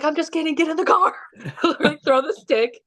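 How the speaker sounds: high, laughing tease. Retold or imitated. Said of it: "And I was like".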